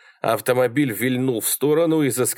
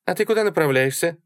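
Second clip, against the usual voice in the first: high, quick question; chatty woman; 8 semitones higher, faster than usual. A narrator's reading. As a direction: ask brightly in a woman's voice. Sally chats up the driver. (быстрый вопрос высоким женским голосом)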